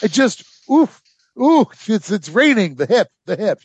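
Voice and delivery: hammed-up groaning over an achy hip; mock aches and pains. Wounded man making excuses to skip the fighting, mock pained.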